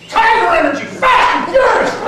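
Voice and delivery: loud, high shouted boast; tiger roar. Acted. Tiger shouting its energy to the rabbit, loud and high. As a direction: yell it out with laughing pride.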